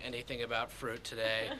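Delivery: normal talking voice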